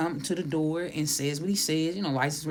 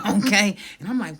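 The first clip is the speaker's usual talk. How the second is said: ordinary talk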